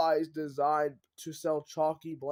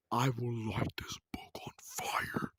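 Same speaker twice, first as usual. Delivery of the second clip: a slow, voiceless threat muttered aside; threatening whisper; 7 semitones lower, 5 dB quieter than usual. Acted. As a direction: hiss it under your breath, slowly.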